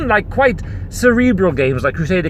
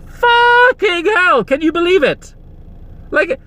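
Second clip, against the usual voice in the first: higher, slow, drawn-out exclamation of disbelief; outraged outburst; 11 semitones higher, slower than usual. Real, not acted. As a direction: let the outrage burst out high and stretched. Outraged outburst about developers.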